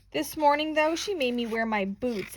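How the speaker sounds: usual speaking voice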